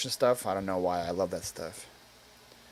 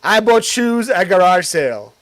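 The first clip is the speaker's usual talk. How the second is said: louder, punchy headline read; mock title pitch; 4 semitones higher, 8 dB louder than usual. Shown as a demonstration. Streamer pitching own next video title, loud. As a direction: announce it like a viral video. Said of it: "that's my next title".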